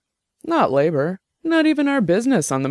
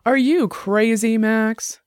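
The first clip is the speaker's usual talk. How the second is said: getting loud; 8 semitones higher, much slower than usual. Acted. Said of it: "her voice getting loud".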